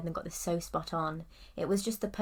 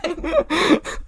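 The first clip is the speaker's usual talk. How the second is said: laughing; 12 dB louder than usual. Real, not acted. Laughing after the accent is mocked.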